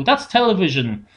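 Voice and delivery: ordinary talk, nothing marked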